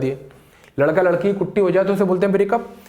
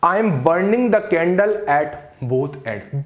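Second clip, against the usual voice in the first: slow clear dictation; much slower than usual. Shown as a demonstration. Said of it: "अगला है".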